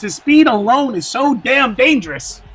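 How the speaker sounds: ordinary delivery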